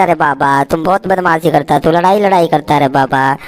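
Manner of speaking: low, scolding grown-up voice; mock scolding. Narrator scolding the toy heroes for fighting, mock stern. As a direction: tell off naughty kids in a deep voice.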